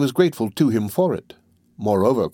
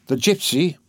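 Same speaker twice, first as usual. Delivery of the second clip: startled exclaim; 4 semitones higher than usual. Acted. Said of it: "he exclaimed, almost frightened".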